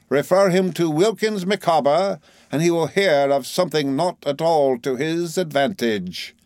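high, grandly declaimed taunt; triumphant flourish. A narrator's reading. Micawber warns Heap about the ashes, triumphantly. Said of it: "The triumphant flourish with which Mister Micawber delivered himself".

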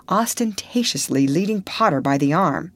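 ordinary delivery

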